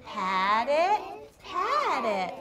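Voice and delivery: slow, sing-song read-aloud, stretching each word; pointing at each word as you read slowly; reading a poem to children. Acted. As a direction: lead the class through the poem line by line. Teacher rereads poem.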